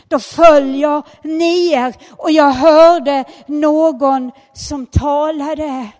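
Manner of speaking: loud and slow, each word weighted; slow loud preacher proclamation; booming, slow preaching. Acted. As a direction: proclaim it from the pulpit, drawn out. Preacher repeats the line, now booming.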